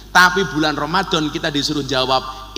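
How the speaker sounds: ordinary talk, nothing marked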